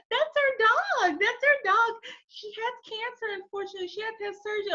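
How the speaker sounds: lightly voiced host family, high and matter-of-fact; high mock host voice. Host family explains their sick dog, high breezy voice. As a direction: pitch it up, breezy and unbothered. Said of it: "And they're like".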